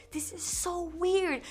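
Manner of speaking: high, slow, drawn-out aside; retold discomfort. Retold reaction to the scene.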